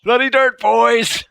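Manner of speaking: high, loud triumphant whoop; excited cheer. Real, not acted. Gamer cheering to his viewers, high and loud. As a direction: cheer it to the lads.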